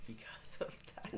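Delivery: whispered confession, half laughing; whispered aside. Real, not acted. Host admitting he was in ESL, whispered aside. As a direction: drop to a whisper, a bit embarrassed.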